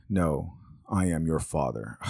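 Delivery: low, slow villain impression; Darth Vader. Correcting the misquoted movie line, in a low, slow villain voice. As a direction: take it down soft and deliberate, like the villain. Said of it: "what Darth Vader actually says".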